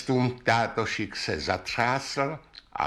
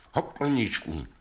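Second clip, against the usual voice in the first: ordinary delivery, nothing marked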